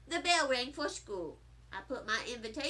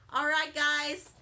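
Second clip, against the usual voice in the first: usual speaking voice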